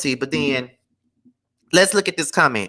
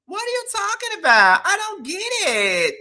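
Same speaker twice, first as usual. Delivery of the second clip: mock Krishan; 11 semitones higher than usual. Acting Krishan's confused reply.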